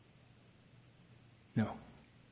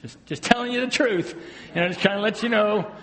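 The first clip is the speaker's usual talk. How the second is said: higher, sweetly pointed mock wife; imitating wife; 6 semitones higher than usual. Husband mimicking his wife about the fog line, sweet and pointed. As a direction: play the helpful wife correcting him. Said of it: "my wife helps with that".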